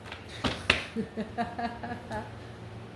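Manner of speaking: soft, breathy run of giggles; quiet giggle. Real, not acted. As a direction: laugh softly to yourself. Amused at own question.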